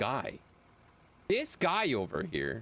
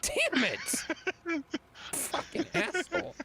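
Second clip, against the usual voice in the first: bad British accent; 11 semitones higher, slower than usual. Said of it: "not even a good British accent".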